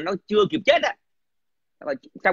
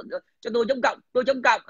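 higher mock proclamation; mock protester; 5 semitones higher, faster than usual. Mocking anti-communists who raise their hands, in a raised mock-protest voice. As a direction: lightly imitate a protester calling out.

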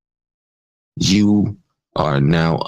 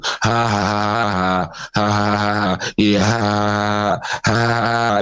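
exaggerated sarcastic fake laugh; mock laughter; 3 semitones higher than usual. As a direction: laugh mockingly at the trolls. Mocking the trolls who laugh.

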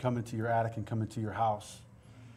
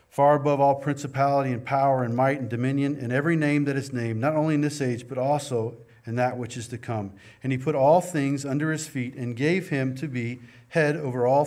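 louder, preached reading of scripture; 6 dB louder than usual. Acted. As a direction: proclaim the verses to the church. Reads Ephesians aloud.

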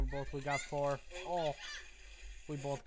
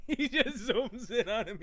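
laughing while talking; 3 semitones higher than usual. Real, not acted. Laughing at the video.

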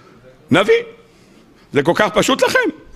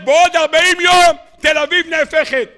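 mock prophet's shout; 6 semitones higher than usual. Man shouting doom at Tel Aviv, shouted warning. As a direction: cry it out to the whole city. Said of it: "עומד בן אדם וצועק".

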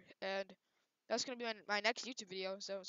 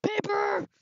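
excited gasp; 8 semitones higher, 11 dB louder than usual. Real, not acted. Spots prey, gasps loudly.

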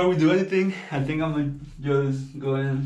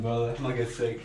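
usual speaking voice

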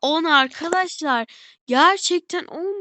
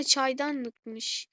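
ordinary talk

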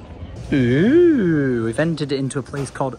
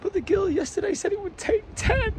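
mocking friend; 4 dB quieter than usual. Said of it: "She's like".

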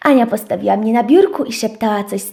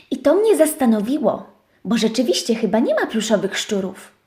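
ordinary delivery, nothing marked

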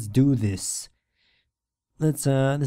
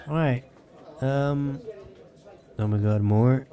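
usual speaking voice

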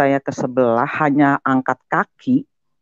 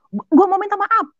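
shouting an apology. Retold or imitated. Son shouting to his parents that he wants to apologise. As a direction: blurt out the apology at full voice. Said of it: "saya gituin".

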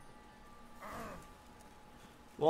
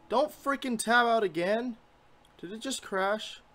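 higher and louder, exasperated at the game crashing; frustrated outburst; 9 semitones higher, 3 dB louder than usual. Real, not acted. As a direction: blurt it out, fed up with the glitch. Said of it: "Sorry, I'm getting ticked".

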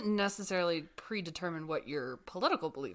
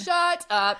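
surprised; 10 semitones higher, 9 dB louder than usual. Real, not acted. Disbelief at a birthday coincidence.

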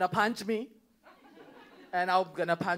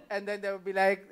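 ordinary delivery

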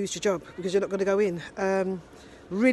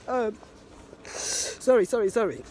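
tearful. Real, not acted. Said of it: "very emotional".

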